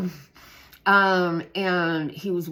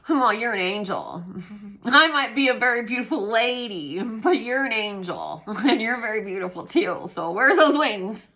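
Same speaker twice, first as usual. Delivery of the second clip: louder, higher laughing back; laughing reply; 7 semitones higher, 8 dB louder than usual. Retold or imitated. The teacher answering the girl's compliment, laughing loudly. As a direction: laugh it out, touched and delighted. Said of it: "And I'd laugh like that".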